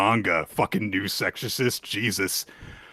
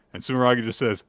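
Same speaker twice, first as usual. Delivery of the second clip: ordinary delivery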